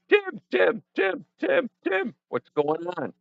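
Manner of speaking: high, repeated mock calls; playful high-pitched calling, slowing at the end. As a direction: hail someone way in the back. Calling to Tim way in back.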